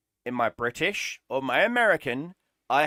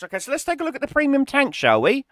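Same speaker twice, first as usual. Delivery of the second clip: higher, rushed mock-cheerful tone; sarcastic; 10 semitones higher, 3 dB louder, faster than usual. Real, not acted. Mocking the push toward premium tanks, fake-cheery. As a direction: breeze through it with fake enthusiasm.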